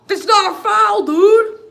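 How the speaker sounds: very high, loud protest; indignant shout. Real, not acted. Fan disputing the referee's call, indignant shout. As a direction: shout it in outraged disbelief.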